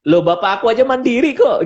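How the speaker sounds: mild mock protest from a wife. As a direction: push back as the wife, lightly voiced. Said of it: "yang ada di persepsi wanita".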